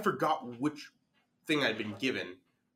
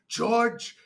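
higher, louder mock-angry call; furious teacher calling; 8 semitones higher, 5 dB louder than usual. Furious French teacher spotting a student in the hall, angry call. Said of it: "she's furious".

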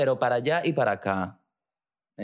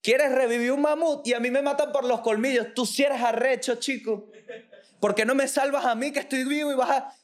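much higher, strange voice of an indignant elephant; talking elephant; 11 semitones higher than usual. Acted. Elephant complaining to the scientists, high and indignant. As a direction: play the fed-up elephant, pitched way up. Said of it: "Dice el elefante".

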